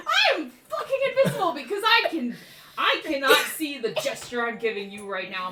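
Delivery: high, loud comic indignation; hammed-up outraged character; indignant retort. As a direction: snap back, insulted, with laughter underneath. Caught invisible character snaps back.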